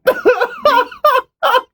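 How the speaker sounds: high burst of laughter; real, amused laugh. Real, not acted. Co-host cracking up at the crude story, laughing.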